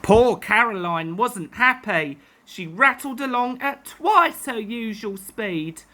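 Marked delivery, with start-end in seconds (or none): none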